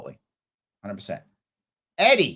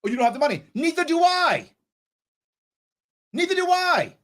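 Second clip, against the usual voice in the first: heated retort; 8 semitones higher than usual. Real, not acted. Angry retort to creditor.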